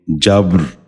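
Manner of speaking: جبر said "jabr", no vowel between b and r; b running straight into a rolled r in جبر; correct pronunciation. Shown as a demonstration. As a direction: say جبر as one syllable, "jabr". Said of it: "آپ بھی غور سے سنیے".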